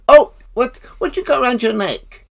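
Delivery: high, loud coaxing clown call to the back row; teasing party clown reaching out to the adults; playful clown voice. Shown as a demonstration. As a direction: draw the grown-ups in with a cheeky high voice.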